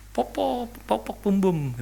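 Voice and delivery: high, lightly voiced two-year-old pleading; imitating a toddler. Preacher voices toddler asking grandma to pat him, high and pleading. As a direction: pitch it up like a tiny child begging grandma. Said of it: "umur dua tahun".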